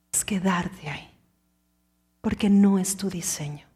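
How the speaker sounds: soft whispered close. Acted. Preacher to listeners about fear, hushed whisper. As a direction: lean in and whisper the truth.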